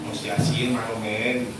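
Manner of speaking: low, hushed wheedling voice; soft coaxing. Retold or imitated. Preacher imitating tempting spirits, hushed and wheedling. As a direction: coax him in gently like a tempter.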